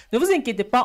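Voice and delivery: ordinary delivery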